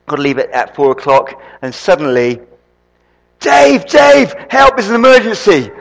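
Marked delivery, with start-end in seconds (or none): 3.4-5.7 higher and louder panicked call of the name; calling for help. Retold or imitated. Wife shouting upstairs to Dave for help, higher and louder. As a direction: yell up the stairs that it's urgent.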